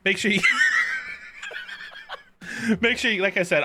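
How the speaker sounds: words broken by laughter; laughing. Real, not acted. Bursting into laughter.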